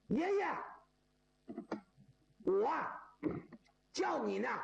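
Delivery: 爷爷 called out high, long pause, then 我 叫你呢; 高喊“爷爷”，停顿后说“我叫你呢”; corrected phrasing. Retold or imitated. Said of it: "想办法就是爷爷叫你呢".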